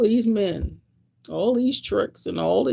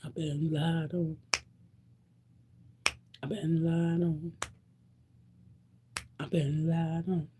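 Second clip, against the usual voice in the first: soft mournful refrain; 7 dB quieter than usual. Real, not acted. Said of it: "repeated hushed lament".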